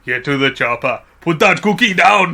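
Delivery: rising into a loud Arnie line; hammed-up Arnie quotes; Arnold Schwarzenegger impression. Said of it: "That was bad".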